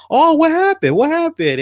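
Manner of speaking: very high, loud, frantic falsetto; panicking dad squeaking his questions; squeaky worried dad. Retold or imitated. Kevin Hart as the dad frets to the boy, high panicked squeak. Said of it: "He's like".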